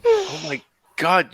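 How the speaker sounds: stunned realization. Acted. Marty recognizes his mother.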